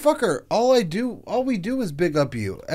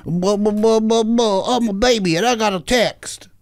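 mock babble; 4 semitones higher, 3 dB louder than usual. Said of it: "And you go".

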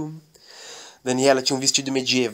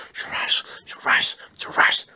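unvoiced whispered chant; panicked girl crawling, whispering; whispered crawling noise; slower than usual.

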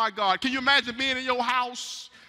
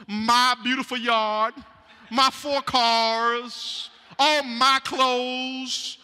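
loud, drawn-out bragging over his possessions, slow; hammed-up bragging homeowner; boastful bragging; 5 dB louder, slower than usual. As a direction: show off every item, slowly, savoring each. Preacher voicing boastful man.

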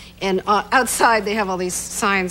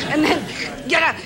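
ordinary talk

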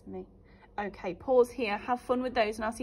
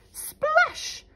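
much higher, louder splash; exclaimed splash; 7 dB louder than usual. A narrator's reading. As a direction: burst out with the splash. Troll falls in river.

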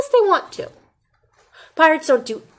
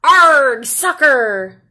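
loud, higher shout; pirate yell; 4 semitones higher, 9 dB louder, much slower than usual. A narrator's reading. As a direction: yell it while kicking the ball. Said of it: "kicked the ball and yelled".

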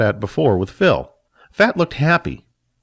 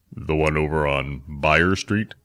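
much lower, softer and slow; a thoughtful elder checking; Phil asking; 7 semitones lower, 5 dB quieter, slower than usual. Acted.

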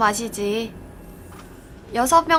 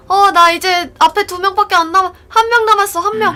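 high, loud rising excitement; nearly there, can't contain it; excited; 6 semitones higher, 7 dB louder, faster than usual. Real, not acted. 차례가 다가오며 흥분. (신나서 들뜬 목소리)

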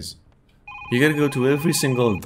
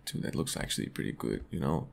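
quick whisper, much lower and softer; whispered aside; 9 semitones lower, 12 dB quieter, faster than usual. Real, not acted. Streamer whispering an aside to viewers. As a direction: murmur it under your breath.

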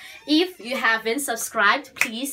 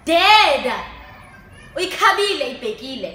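loud, higher-pitched angry order; angry outburst; 6 semitones higher, 9 dB louder than usual. Acted. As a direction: shout them out of the way. Acted angry order in monologue.

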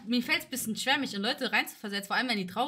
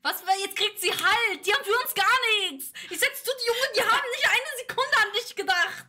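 much higher, louder, exasperated; outraged disbelief; 10 semitones higher, 6 dB louder than usual. Real, not acted. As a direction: protest loudly at the injustice. Outraged on friend's behalf.

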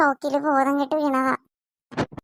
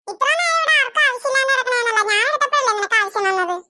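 put-on whiny child retort; 8 semitones higher, faster than usual. Children quarrel over a ball.

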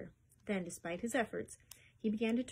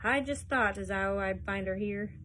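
louder, knowing and smug; a small man gloating over his find; sly Hines voice; 5 dB louder than usual. A narrator's reading. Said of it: "he cried".

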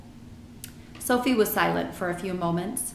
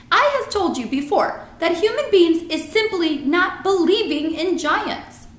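exasperated giant, higher and louder; BFG; 10 semitones higher, 7 dB louder than usual. A narrator's reading. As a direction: lay down the law as a friendly giant.

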